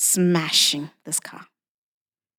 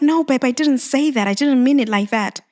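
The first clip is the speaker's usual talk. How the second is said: higher, pleading reassurance; pleading with husband; 6 semitones higher, 5 dB louder than usual. Retold or imitated. Wife reassuring upset husband, higher pleading voice. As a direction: lift the voice, calming him. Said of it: "And I'm like".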